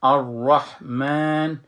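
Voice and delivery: الرحمن stretched slowly with a heavy ra; full ra. Shown as a demonstration. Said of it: "را میخوانم".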